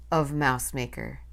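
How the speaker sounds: ordinary delivery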